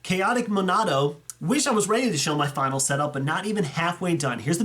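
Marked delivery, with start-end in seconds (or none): none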